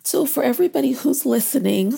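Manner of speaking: high, wavering, regaining composure; pushing through the emotion; steadying shaky voice. Real, not acted. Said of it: "I'm getting a little emotional here".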